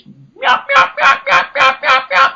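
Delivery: shrill rapid hen yelps mimicked in a very high voice; high fast yelps. Shown as a demonstration. Hunter demonstrating the plain yelp to viewers, very high voice. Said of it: "Just that high pitch".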